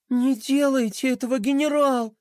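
high, pleading princess voice. Acted. Pinky begging the general to stop, pleading. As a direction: beg him not to. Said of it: "взмолилась она".

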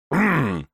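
loud cough into the fist; throat-clearing cough. Acted. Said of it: "кашлянул Ичиро в кулак".

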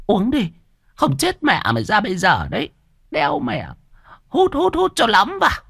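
put-on high-pitched nagging old shopkeeper; old woman voice. Old shop owner nagging the debtor, high old woman voice. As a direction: needle him about his debt like a sharp old woman.

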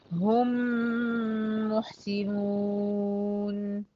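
"هم محسنون" with the two m's merged in a long nasal hum; recited with ghunna. Shown as a demonstration. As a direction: recite slowly, stretching the m and the final "ون" of "محسنون". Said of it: "الأداء هيبقى معايا بغنة". (رتّل "هم محسنون" ببطء مع غنة طويلة في الميم)

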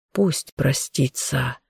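slow, soft and sorrowful; sad. A narrator's reading. The elder sadly agrees to let her say goodbye. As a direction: let it go with a heavy heart. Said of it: "с грустью согласился".

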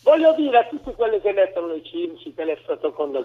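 normal talking voice

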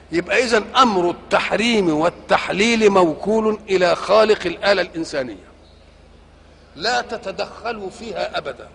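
ordinary talk

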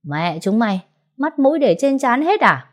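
put-on drunken cursing. Drunk man bumped into the girls, angry cursing. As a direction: snap at them like a drunk. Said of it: "hắn ta đã chửi um lên".

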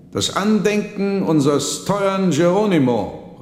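a high, loud toast; called out toast. A narrator's reading. Monk raises his wine glass to the dead son, calling loudly. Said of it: "rief er".

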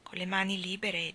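ordinary delivery, nothing marked

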